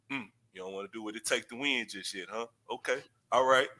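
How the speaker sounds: low, soft and unbothered; calm coach. Retold or imitated. Player walked off, coach answers low, soft, cool. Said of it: "he would be cool with that".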